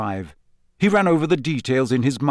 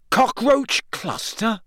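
higher, slow wondering question; amazed that it worked; 10 semitones higher, slower than usual. A narrator's reading. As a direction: say it in disbelief. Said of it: "he said, amazed".

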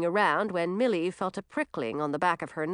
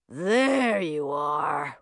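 slow rumbling troll voice; 2 semitones lower, much slower than usual. Acted. Troll finding the lost friends, slow rumble. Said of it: "rumbled a voice".

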